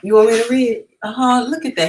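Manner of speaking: delighted. Real, not acted. Delighted to be asked to read.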